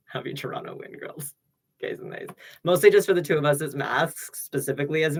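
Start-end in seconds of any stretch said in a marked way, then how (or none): 0.1-2.3 soft unvoiced whisper. Real, not acted. Streamer whispering greeting to chat, unvoiced. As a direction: hush it like a secret.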